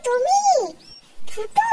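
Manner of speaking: coy call. Acted. Girl calls her sweetheart.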